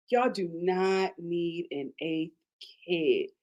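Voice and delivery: slow, low "do not need", words spaced out; slow emphasis. Real, not acted. Host warning the couple about another baby, slow emphasis. Repeats plea slowly for emphasis.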